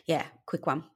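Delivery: normal talking voice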